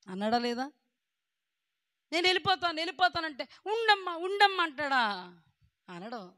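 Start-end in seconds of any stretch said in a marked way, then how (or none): 2.1-4.6 higher pleading voice, mocking someone who begs; mock begging in a higher voice. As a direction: whine "stay, dear" in a higher tone. Mock pleading of a departing person.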